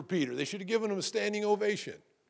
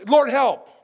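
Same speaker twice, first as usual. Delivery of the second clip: loud, higher cry of Lord, help; short cry for help; 6 semitones higher, 11 dB louder than usual. Shown as a demonstration. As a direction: cry it out urgently.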